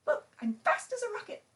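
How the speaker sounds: high, loud, fast boast; excited bird character. Acted. Bird showing off to teacher as a rocket, excited. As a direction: show off in a high, rushed burst.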